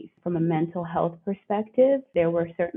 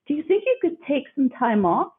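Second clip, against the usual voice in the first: put-on Aussie accent; 8 semitones higher than usual. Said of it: "in his Aussie accent".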